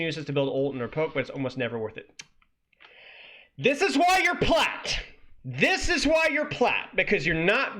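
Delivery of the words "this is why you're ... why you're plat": high-pitched, drawn-out shout of disbelief; slow it right down and holler it; exasperated yell. Real, not acted. Berating the guide's writer for being plat, exasperated yell. Exasperated outburst at bad advice.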